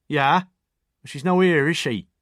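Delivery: suspicious Oxfordshire woman on the phone; Oxfordshire accent. A narrator's reading. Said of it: "her curly Oxfordshire accent".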